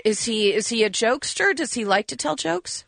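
ordinary talk

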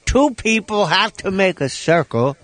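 slow, put-on comedian voice; Buddy Hackett. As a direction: draw it out in a comic impersonation. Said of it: "as Buddy Hackett once told me".

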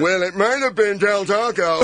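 high, put-on rambler's voice; dithering storyteller. Joins in mocking rambling storyteller.

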